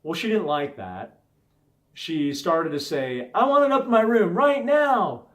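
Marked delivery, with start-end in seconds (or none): 3.4-5.3 put-on high, loud whiny kid demand; demanding child. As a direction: imitate the daughter insisting, loud and high. Child demanding, escalating fight.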